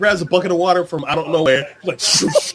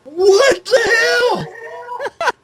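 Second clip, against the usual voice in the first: high, shouted disbelief; incredulous outburst; 5 dB louder, much slower than usual. Retold or imitated. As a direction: squawk it out, stretched, utterly baffled. Exclaimed disbelief at the story.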